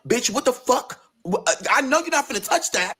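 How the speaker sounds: high-pitched, appalled disbelief; stunned, stammering protest in a high voice; gagged, shocked. Retold or imitated. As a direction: act out horrified shock, voice shooting up. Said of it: "I'm gagged".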